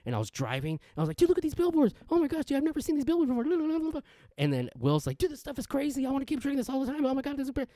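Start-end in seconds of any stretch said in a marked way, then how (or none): 1.2-4.0 very high, gushing wonder; mock amazed excitement. Retold caffeinated awe while driving. 5.2-7.7 very high, rushed gushing; imitating hyped friend. Friend's retold coffee raving.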